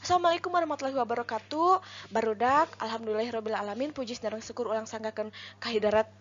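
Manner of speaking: rattled-off speech opening; far too fast. Shown as a demonstration. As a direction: rush through it so listeners can't follow. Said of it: "pami gancang teuing".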